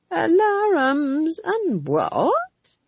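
high, very drawn-out puzzled question; inattentive query. A narrator's reading. Witch who hadn't been listening, asking puzzled. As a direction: stretch it high as if you missed it. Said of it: "who hadn't been listening".

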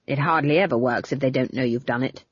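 put-on low stern old woman; stern old witch. Granny Weatherwax voice.

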